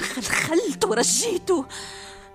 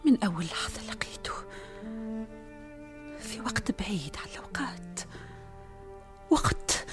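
whispered love confession; 6 dB quieter than usual. Acted. Princess confides her secret love.

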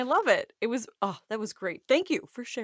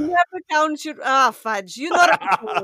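laughing; 4 semitones higher, 6 dB louder than usual. Real, not acted. Breaking up mid-song.

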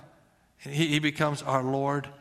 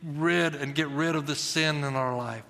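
prayer; 3 semitones lower than usual. Real, not acted. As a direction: pray gently over the room. Pastor prays aloud.

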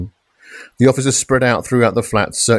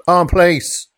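bellowing police shout; 10 semitones higher than usual. A narrator's reading. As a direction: bellow it as you storm in. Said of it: "they bellowed".

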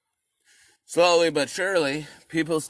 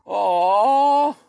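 mock aww; 7 semitones higher, 6 dB louder than usual. Mock pity.

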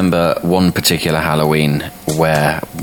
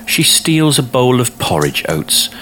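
lightly voiced prosecutor, pitched up; higher, accusing prosecutor's voice; 7 semitones higher than usual. A narrator's reading. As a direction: state the charge in a higher courtroom voice. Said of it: "the prosecutor notes".

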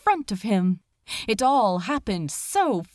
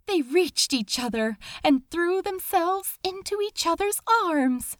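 ordinary delivery

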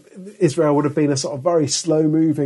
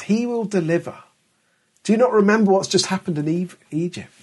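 rebuke; 6 semitones higher than usual. Retold or imitated. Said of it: "more of an angry rebuke".

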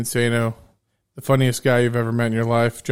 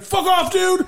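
high-pitched mock tirade; crazed lunatic; 6 semitones higher than usual. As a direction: rant like a comic madman issuing a threat. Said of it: "who's the crazed lunatic".

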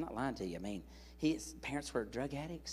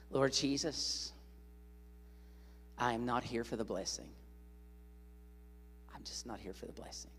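prayer; 5 dB quieter than usual. Shown as a demonstration. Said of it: "Can you say this?".

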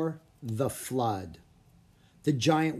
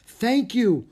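loud, high cry of thanks; 10 semitones higher, 8 dB louder than usual. A narrator's reading. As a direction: call out thanks over the storm. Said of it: "the boy's father cried".

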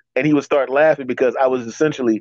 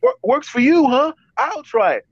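high-pitched imitation of a friend; imitated friend; 13 semitones higher than usual. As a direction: play the friend agreeing, voice pitched up. Acts out friend taking the advice.

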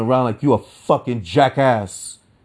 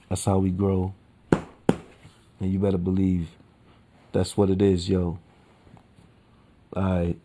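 soft sign-off; 3 semitones lower, 7 dB quieter than usual. Acted. Podcast sign-off motto.

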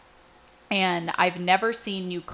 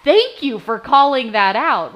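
grateful realization; 3 semitones higher, 7 dB louder, slower than usual. Retold or imitated. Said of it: "I go".

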